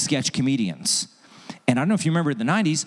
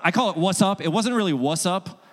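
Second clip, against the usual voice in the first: ordinary delivery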